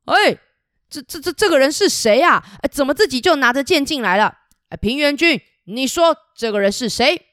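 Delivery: a shocked, indignant king demanding answers; king caught off guard, voice jumping up; startled angry king. Acted. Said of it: "很生气".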